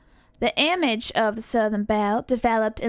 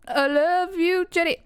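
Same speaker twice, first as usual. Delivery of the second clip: Southern film quote; 6 semitones higher than usual. Acted. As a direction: draw it out high, Southern accent. Acted film quote to Jenny.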